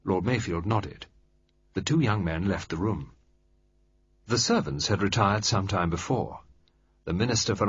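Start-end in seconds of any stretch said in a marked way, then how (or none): none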